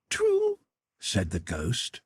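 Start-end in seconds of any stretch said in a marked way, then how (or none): 0.1-0.6 very high, thin otherworldly voice; ghostly spirit voice. A narrator's reading. The Ghost tells Scrooge of his sister, high and otherworldly.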